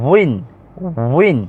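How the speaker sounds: উইন as one syllable "win", starting on a rounded w; careful waw pronunciation. Shown as a demonstration. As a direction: demonstrate the w glide in উইন. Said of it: "তো এটার উচ্চারণ হচ্ছে". (উইন-এর শুরুতে ওয়াও-এর স্পষ্ট উচ্চারণ)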